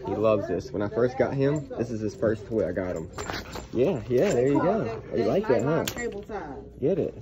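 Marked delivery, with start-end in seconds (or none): none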